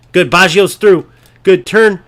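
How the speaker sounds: loud, urgent play-by-play yell; excited shouting. Real, not acted. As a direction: shout at the screen.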